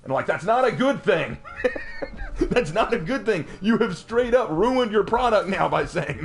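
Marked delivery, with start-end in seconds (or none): 1.4-2.4 sudden high-pitched burst of laughter; high cackle. Real, not acted. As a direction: laugh out at the absurdity. Laughing at the developers' claim.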